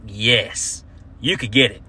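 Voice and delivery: dropped low, suggestive; low flirty mock. Comic flirting at the husband, low and suggestive. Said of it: "I was like".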